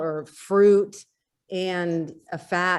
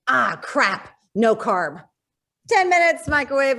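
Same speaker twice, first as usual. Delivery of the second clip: excited exclamation; 6 semitones higher, 5 dB louder than usual. Retold or imitated. Mimics her rushed morning self.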